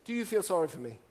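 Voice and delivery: ordinary delivery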